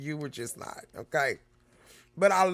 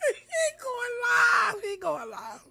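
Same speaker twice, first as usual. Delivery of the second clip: mock scream; 4 dB quieter, much slower than usual.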